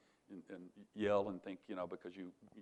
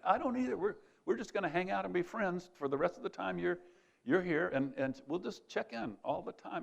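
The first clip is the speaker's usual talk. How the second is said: much higher, beaming back at him; joyful reply; 9 semitones higher than usual. Retold or imitated. Teacher hugging the freed student, high and happy. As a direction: answer with matching delight.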